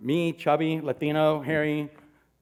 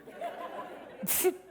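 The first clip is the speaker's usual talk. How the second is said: a high airy laugh at himself; high breathy laugh; 8 dB quieter than usual. Real, not acted. Comic laughing at his own story, high airy laugh. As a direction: laugh off the awkward moment.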